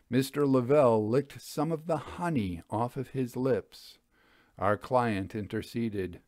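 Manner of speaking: ordinary delivery, nothing marked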